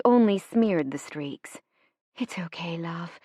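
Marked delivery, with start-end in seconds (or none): 2.2-3.2 very soft, hushed comfort; soothing promise. A narrator's reading. Mother to crying baby after the blast, soothing. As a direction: soothe a crying child, barely above a whisper. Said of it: "she promised".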